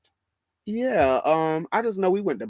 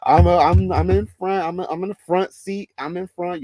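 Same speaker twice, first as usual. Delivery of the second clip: boss-like bragging; 5 semitones higher, 5 dB louder than usual. Retold or imitated. Worker above everybody on the stand, boastful. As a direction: act out feeling like the boss up front. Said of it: "I felt like a boss".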